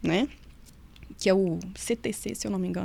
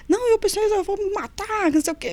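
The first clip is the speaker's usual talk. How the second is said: pitched up, pleading and insistent; desperate inmate; 9 semitones higher than usual. Retold or imitated. Inmate begging the guard, pitched-up desperate plea. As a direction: voice the inmate begging high and urgent.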